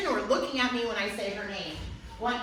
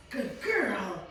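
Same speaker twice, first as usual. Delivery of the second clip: ordinary talk, nothing marked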